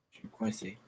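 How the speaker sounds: voiceless tense whisper; scared whisper. Real, not acted. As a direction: whisper it, trapped. Trapped by zombies in game.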